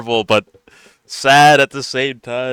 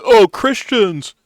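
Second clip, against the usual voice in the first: mock shock; 8 semitones higher, much slower than usual. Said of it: "These guys are like".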